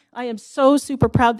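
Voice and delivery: proud, emphatic restatement; emphatic pride. Real, not acted. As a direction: lean into the word so. Repeats with more force.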